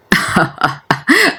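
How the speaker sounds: a high, light burst of laughter. Acted. Adham laughing at his grandfather's flirting, high laugh. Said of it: "ورد أدهم".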